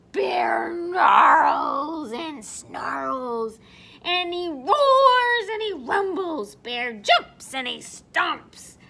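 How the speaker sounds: loud, drawn-out, emphatic storytelling; roaring narration. A narrator's reading. Storyteller acts out the bear's tantrum, loud and drawn out. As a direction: stretch the angry verbs out loud.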